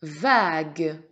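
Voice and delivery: vague loud and drawn out; emphatic dictation. Shown as a demonstration. Teacher repeats for the pupil, vague loud and stretched. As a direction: stretch vague, sounding the final "gue".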